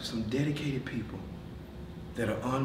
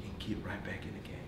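whispering; 5 semitones lower, 14 dB quieter than usual. Real, not acted. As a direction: drop to a whisper. Hushed closing emphasis.

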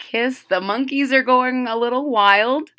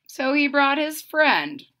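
ordinary delivery, nothing marked

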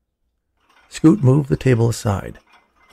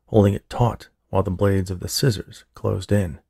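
plain reading voice

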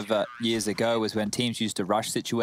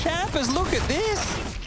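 high, drawn-out excited cry; excited shout; much slower than usual. Real, not acted. Caster hyping a teammate's flash play, high and slow excited cry. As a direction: call the play at the top of your voice.

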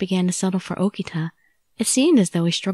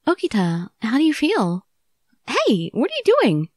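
alarmed; 6 semitones higher than usual. Acted. Chizuru alarmed as Okita gets up.

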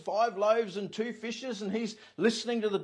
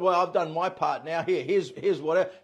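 smug; 4 semitones lower, 5 dB louder than usual. Retold or imitated. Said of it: "and sort of".

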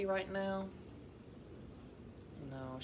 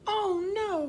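high, loud wail of dismay; storybook cry of horror; distressed cry; 10 dB louder than usual. A narrator's reading. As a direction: cry out in hammed-up alarm. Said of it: "cried Dora".

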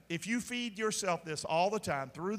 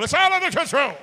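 louder, higher mock reassurance, "It's all under control." said with a sneer; 7 semitones higher, 12 dB louder, faster than usual. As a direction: mimic a smug official brushing it off. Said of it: "don't lie to us and say it ain't happening".

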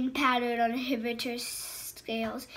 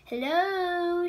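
mock sing-song call; 6 semitones higher, 6 dB louder than usual. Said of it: "she called".

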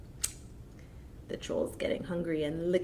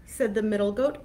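plain reading voice

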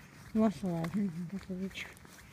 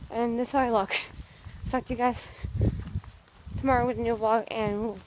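vlog outro; 3 semitones higher, 4 dB louder, faster than usual. Acted.

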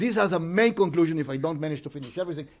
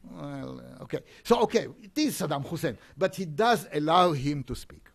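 ordinary delivery

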